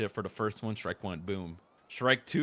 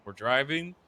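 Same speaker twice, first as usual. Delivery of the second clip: ordinary delivery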